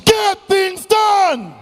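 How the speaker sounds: very high, very slow rallying cry; full conviction shout. Shown as a demonstration. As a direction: roar it for the whole room, stretched. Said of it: "Say it after me one last time with conviction".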